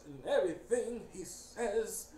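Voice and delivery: very slow, stretched-out villain voice; Mister Price. Retold or imitated. Imitating a corporate dude, painfully slow voice. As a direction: drag out each word painfully. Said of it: "sounds like this".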